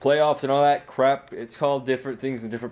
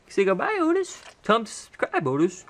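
much higher sing-song coaxing of the dog; dog talk; 9 semitones higher than usual. Real, not acted. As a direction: coo at the dog in a high voice.

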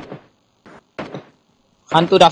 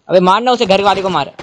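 urgent shout; 5 semitones higher, 4 dB louder than usual. Real, not acted. Urging teammate to shoot enemy.

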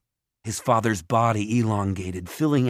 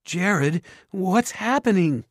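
high, anxious question; raised, worried voice; mother calling out; 10 semitones higher, slower than usual. A narrator's reading. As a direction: call out in bewildered alarm. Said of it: "their mother called".

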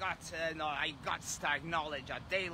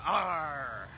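louder mock pirate arr in a changed voice; pirate growl; 4 dB louder than usual. Streamer signs off the pirate dare with a mock growl. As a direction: give a last hammed-up pirate growl. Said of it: "acknowledge the dare".

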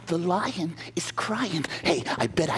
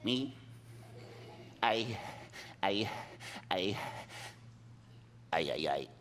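very soft stammering; stammer, too scared to answer; timid stammer; 5 semitones lower, 15 dB quieter than usual. Acted. Mouse stammers fearfully to the lion, very soft.

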